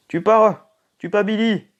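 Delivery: loud high yell at a player; shouting. Real, not acted. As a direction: shout after someone leaving. Yelling at game character.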